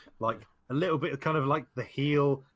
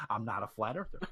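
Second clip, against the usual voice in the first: lower, softer mock country accent; 4 semitones lower, 6 dB quieter than usual. AJ denying it once more, in a mock country accent. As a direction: imitate a Southern country denial. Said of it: "country accent he's got".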